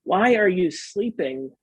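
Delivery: usual speaking voice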